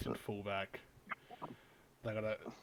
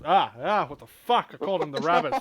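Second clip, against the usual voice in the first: embarrassed yelling; 6 semitones higher, 12 dB louder than usual. Real, not acted. Mortified at misnaming team.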